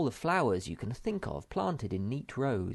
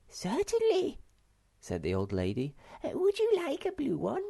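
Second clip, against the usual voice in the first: put-on little old lady. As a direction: greet the children in a bright squeaky voice.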